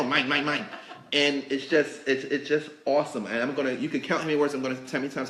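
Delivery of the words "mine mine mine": lightly voiced seagulls. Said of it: "like the seagulls from Finding Nemo".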